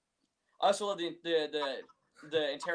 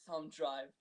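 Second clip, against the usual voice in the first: softer, offhand imitation; understated throwaway movie line; nonchalant; 7 dB quieter than usual. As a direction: drop it casually, quite unbothered. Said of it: "I love just the nonchalant".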